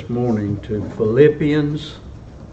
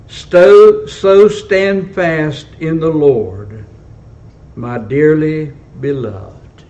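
measured pulpit reading in long-paused phrases; solemn scripture reading; 3 semitones higher, 4 dB louder, slower than usual. A narrator's reading. Preacher reading Paul's letter to the believers, solemn scripture reading. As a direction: read it out to the congregation, phrase by phrase.

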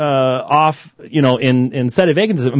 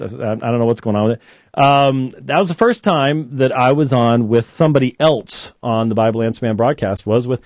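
ordinary delivery

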